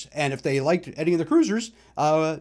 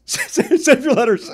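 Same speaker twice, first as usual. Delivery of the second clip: laughing through "Save your letters", slow and higher; 8 semitones higher, 6 dB louder, much slower than usual. Real, not acted. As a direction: give up and laugh while repeating it. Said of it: "repeated laughing".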